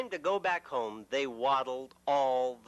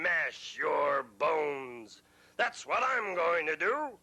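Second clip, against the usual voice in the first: menacing big goat; 3 semitones higher than usual. Acted. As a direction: threaten to crush him. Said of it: "big goat threatens troll".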